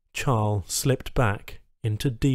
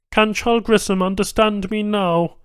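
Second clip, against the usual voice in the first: high Banu tech voice, clinical and louder; alien tech asking; 8 semitones higher, 4 dB louder than usual. A narrator's reading. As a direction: question the patient coolly. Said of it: "the adjusting tech asked".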